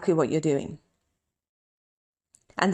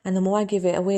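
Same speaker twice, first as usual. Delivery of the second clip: usual speaking voice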